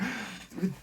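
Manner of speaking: sharp high gasp; scared gasp. Retold or imitated. Comic imitating frightened Amazon driver, high gasp.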